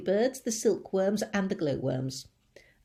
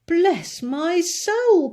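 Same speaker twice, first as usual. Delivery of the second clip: very high, loud, slow astonished cry; cried; 10 semitones higher, 10 dB louder, much slower than usual. A narrator's reading. Fire chief crying out in astonishment at the boy. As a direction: gasp it out in amazement. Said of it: "cried the head of the fire department".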